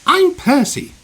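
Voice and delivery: very high, loud little engine voice; cheerful small engine. A narrator's reading. Percy introduces himself to the visiting engine, cheerful. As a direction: pipe up eagerly, high and loud.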